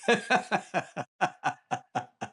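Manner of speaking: real laughter tailing off, high-pitched. Real, not acted. Still amused by co-host's joke, high laugh dying away.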